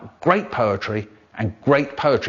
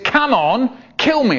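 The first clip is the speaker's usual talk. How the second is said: higher, louder insistent bloke; mock bloke, pushier; 11 semitones higher, 4 dB louder, slower than usual. Stranger on the train repeating his demand, louder and pushier. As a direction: press him harder. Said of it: "And he said".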